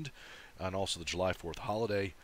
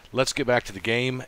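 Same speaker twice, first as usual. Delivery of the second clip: loud call-out; 6 semitones higher, 9 dB louder than usual. Real, not acted. Outro call to viewers.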